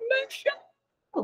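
high, soft, drawn-out call; small high-pitched half-hearted holler; weak mock yell. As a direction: imitate a feeble attempt at yelling. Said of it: "weakly yelling".

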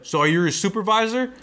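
high mock-clerk question. Clerk asks coworker if he's supervisor, imitated querying.

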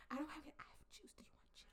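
whispered, fading "Do you want juice?"; mock whisper. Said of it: "What are we doing?".

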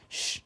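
soft, voiceless shushing; hissed hush. A narrator's reading. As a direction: hush them with a sharp "shh". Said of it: "hissed Professor McGonagall".

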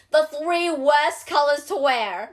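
slow, loud mock Italian accent; imitated Italian accent. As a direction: enunciate it like an Italian TikToker. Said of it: "his Italian accent".

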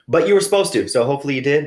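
high, loud teasing nudge; playful insistence. Real, not acted. To podcast listeners about the joke, high teasing nudge. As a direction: push the listener to laugh along. Joking that listeners should laugh.

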